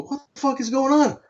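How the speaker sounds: high, stunned what-the-fuck; shocked and thrown, voice jumping high; bewildered outburst. Retold or imitated. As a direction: squeak it out in disbelief. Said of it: "And I go".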